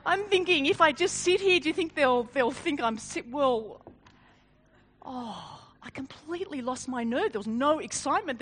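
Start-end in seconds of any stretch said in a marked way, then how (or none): none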